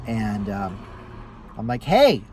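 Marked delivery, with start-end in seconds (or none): 1.8-2.2 raised, higher hail; loud, high "hey" to the back seat; kinda loud. Retold or imitated. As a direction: call out to get someone's attention. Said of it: "I say it kinda loud".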